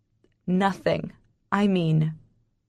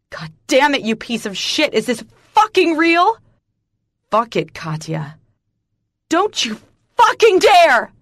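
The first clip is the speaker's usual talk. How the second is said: furious shout, much higher and louder; screaming; 6 semitones higher, 7 dB louder than usual. A narrator's reading. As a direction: scream it at him in rage. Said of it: "I scream".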